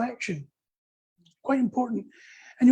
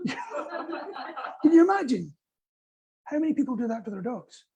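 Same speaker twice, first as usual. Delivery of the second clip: ordinary delivery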